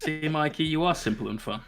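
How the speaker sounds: ordinary talk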